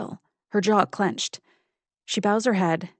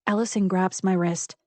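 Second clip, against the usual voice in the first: plain reading voice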